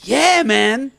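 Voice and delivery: high, drawn-out exclamation; exasperated yell. Retold or imitated. Tenant confirming plumber is the issue, high exasperated cry. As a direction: cry out at the obvious.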